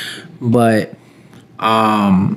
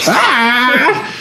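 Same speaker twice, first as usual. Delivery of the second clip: high drawn-out mock bird screech; plucked chicken squawk; 11 semitones higher than usual. Friend mimics the plucked bird for laughs, squawking. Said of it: "look like a freaking chicken".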